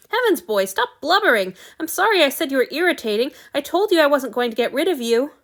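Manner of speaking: flustered master telling him to stop crying; exasperated scolding. A narrator's reading. God scolds crying servant.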